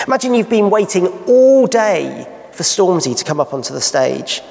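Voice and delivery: usual speaking voice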